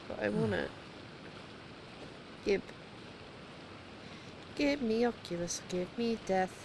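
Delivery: ordinary talk, nothing marked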